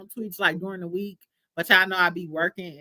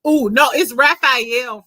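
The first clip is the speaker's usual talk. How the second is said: ordinary delivery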